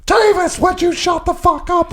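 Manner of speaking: high shrill furious shout; mock angry yell. Pregnant wife yelling at drunk husband, high mock shout. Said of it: "Then I hear".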